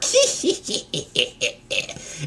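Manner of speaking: drawn-out high, wheezy cackle; villain cackle. Retold or imitated. Villain cackles in retold monologue.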